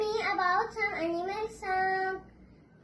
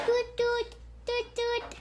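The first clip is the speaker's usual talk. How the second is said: higher toot toot like a horn; train toot; 5 semitones higher than usual. Shown as a demonstration. Child showing a train's horn, higher. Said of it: "Sound train".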